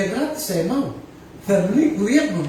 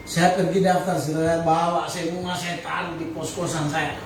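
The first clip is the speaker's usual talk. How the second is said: usual speaking voice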